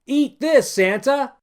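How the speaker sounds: high, loud bratty shout; shouted taunt; taunting yell. Acted. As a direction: yell it like a cheeky kid. Boy taunting Santa.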